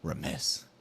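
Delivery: remiss breathed out in a bare whisper; whispered aside. Shown as a demonstration. Repeating his corporate word to himself, whispered. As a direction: let remiss slip out under your breath. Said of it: "one of those corporate terms".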